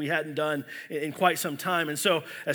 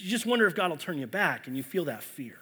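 ordinary talk

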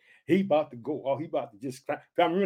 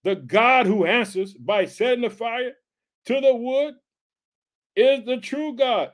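slow, higher preacher's proclamation; scripture reading; 5 semitones higher, 3 dB louder, slower than usual. A narrator's reading. Preacher reading scripture to the congregation, slow proclamation. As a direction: read the verse out with weight, drawn out.

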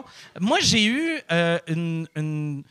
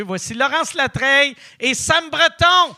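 announcer voice; 7 semitones higher, 6 dB louder than usual. Acted. Introducing guests on the podcast.

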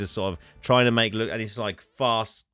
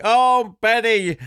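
much higher, louder hammed-up wail; distraught tram driver; 11 semitones higher, 7 dB louder, much slower than usual. Host voicing the tram driver mourning his tram, high loud wail. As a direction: cry out like a man losing his beloved tram. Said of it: "he's like".

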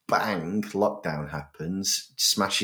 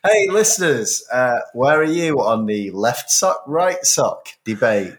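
loud, high breakfast-show host; hammed-up radio DJ blare; standard Radio One presenter; 5 semitones higher, 8 dB louder than usual. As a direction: hype up a phone-in, stretching the ends. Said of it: "That's standard Radio one".

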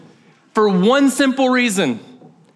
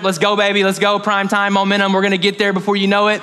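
usual speaking voice

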